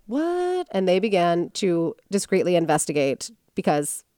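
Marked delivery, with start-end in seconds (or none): 0.1-0.7 high, soft incredulous squeak; hushed high-pitched disbelief; mock astonishment. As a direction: act out a stunned reaction, pitched way up. FBI's astonished reaction, acted out.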